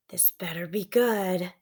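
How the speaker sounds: soft whispered grumble; grumbling whisper. Acted. Annoyed brother heading into woods, soft whisper. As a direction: mutter it under your breath.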